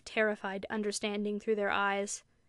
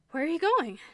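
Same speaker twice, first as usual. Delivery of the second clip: sudden high, louder question; strange, breathless voice; 7 semitones higher, 5 dB louder than usual. A narrator's reading. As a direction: call after her, high and urgent. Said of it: "in a strange, breathless voice".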